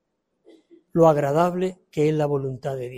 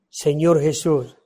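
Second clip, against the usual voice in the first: normal talking voice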